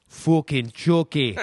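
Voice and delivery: put-on slow, loud, high little-girl growl; angry toddler. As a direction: spit it out like a furious two-year-old. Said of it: "And she's like".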